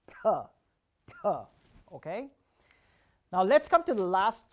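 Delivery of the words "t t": "T" and "t" said as crisp aspirated stops; T sound demo. Shown as a demonstration. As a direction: puff a sharp burst of air on "T, t". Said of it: "the T has to be practiced".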